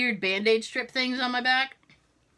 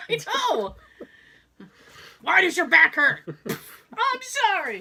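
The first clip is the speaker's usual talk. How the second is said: mock hysterical whimper; 7 semitones higher than usual. Continuing Airplane hysteric bit.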